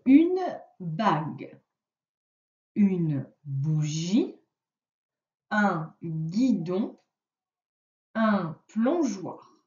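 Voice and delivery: slow, carefully spelled-out word list; slow dictation. Shown as a demonstration. Teacher dictating words to a pupil, slow and clear. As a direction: read each word slowly so the pupil hears the sound. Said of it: "Je vais te dire les mots".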